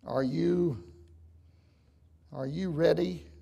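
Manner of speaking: ordinary talk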